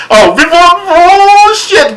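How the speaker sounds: high, loud sudden recall; excited outburst. Real, not acted. Vlogger suddenly remembering a detail for viewers, high excited burst. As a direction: blurt it out as it comes back to you.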